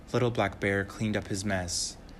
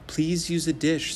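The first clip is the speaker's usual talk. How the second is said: put-on little girl; 7 semitones higher, 4 dB louder than usual. Little girl voiced in story.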